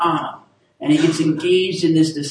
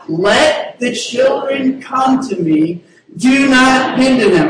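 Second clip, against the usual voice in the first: slow, higher and louder, building to a shout; rallying shout; 7 semitones higher, 6 dB louder, slower than usual. Shown as a demonstration. Pastor rallying the standing crowd, loud and high. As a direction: pump up the crowd on the final line. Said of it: "Come on, come on. Here we go".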